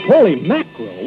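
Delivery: ordinary delivery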